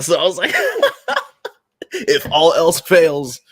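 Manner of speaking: giggling through a cheeky joke; cheeky laughing aside. Real, not acted. Joking about dating South Africans.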